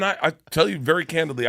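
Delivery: normal talking voice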